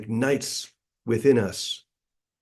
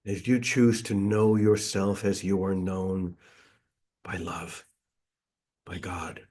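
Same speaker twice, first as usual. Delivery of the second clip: guided meditation; 2 semitones lower, 4 dB quieter than usual. Real, not acted.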